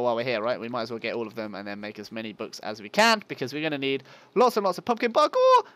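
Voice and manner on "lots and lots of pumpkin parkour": high, loud, hammed-up enthusiasm; mock hype. Player joking about what the build needs, loud mock hype. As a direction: belt it out as a silly boast. Joke slip, corrected next line.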